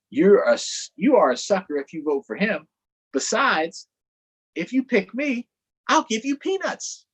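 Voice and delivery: high, cutesy elephant showing off. Acted. Elephant charming voters, high and cutesy. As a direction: sweetly brag about being adorable.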